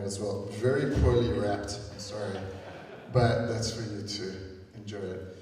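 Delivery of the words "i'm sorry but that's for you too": ordinary talk, nothing marked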